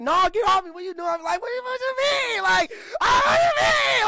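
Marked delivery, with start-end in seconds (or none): 0.0-1.1 high, loud mock protest from a girlfriend; imitated protesting girlfriend. Girlfriend fending off her playful man, high-pitched protest. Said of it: "she be like". 1.4-4.0 very high, loud hammed-up yell; mock indignant shout. Man questioning the girl who pushed him off, mock shouting. Acting out playful tussle with girlfriend.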